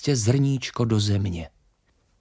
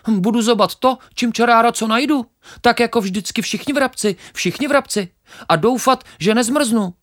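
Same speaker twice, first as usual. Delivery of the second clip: very high, loud, quick chirpy sparrow voice; cheeky sparrow; 11 semitones higher, 5 dB louder, faster than usual. Acted. Sparrow laughs off the grain, very high and cocky.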